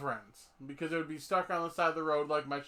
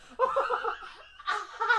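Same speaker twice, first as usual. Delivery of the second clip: helpless high cackle; high-pitched laugh; 14 semitones higher, 3 dB louder than usual. Real, not acted. Host cracks up at the beans joke, high laughter.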